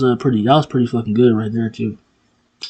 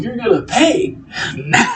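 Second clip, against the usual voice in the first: wrestler's threat, imitated; 10 semitones higher than usual. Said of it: "He's like".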